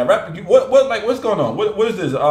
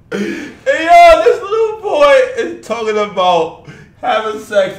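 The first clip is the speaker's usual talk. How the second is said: high, loud laughing shout; laughing outrage; 6 semitones higher, 6 dB louder than usual. Real, not acted. Outraged at kid lyrics.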